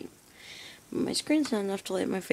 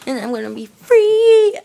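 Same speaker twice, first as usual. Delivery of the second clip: much higher, louder cry of joy; excited shout; 16 dB louder than usual. Real, not acted. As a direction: yell it with glee. School's out excitement.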